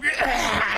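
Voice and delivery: imitated wheezy laugh; short put-on emphysema cackle; mock emphysema laugh. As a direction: mimic a smoker's wheezing laugh. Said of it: "the most emphysema laugh".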